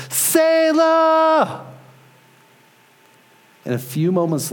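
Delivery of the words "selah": long, high full-voiced shout; top-of-lungs scream. Father calling out for his missing child, screaming. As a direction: scream the name out as far as it will carry. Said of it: "I just scream at the top of my lungs".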